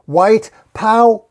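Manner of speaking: loud, high rallying shout; chant-like yell, cut short at the end. A narrator's reading. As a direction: shout it out as a slogan. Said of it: "he starts to yell".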